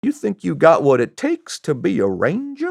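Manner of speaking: high, swooping put-on Southern voice; imitated Southern gent, high "ooh"; Southern accent. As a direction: do a rough Southern plantation-owner impression. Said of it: "I don't have a very good Southern accent".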